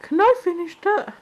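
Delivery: high, eager pet-dog voice; playful imitation of a hopeful dog; voicing the begging dog. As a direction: ask in a put-on doggy voice. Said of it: "The Bug's gonna be like".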